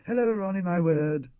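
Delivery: high mock echo of "Hello, Ronnie"; mocking the girl. A narrator's reading. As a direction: parody her careless hello. Madame mocks the girl's greeting.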